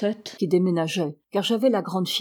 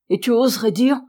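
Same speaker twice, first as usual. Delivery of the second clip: indignant, higher and louder challenge; choking with anger; 4 semitones higher, 6 dB louder than usual. A narrator's reading. Marion challenges the man slandering Tréguerne, choking with anger. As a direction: throw the words out in choked anger. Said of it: "suffoquée par la colère".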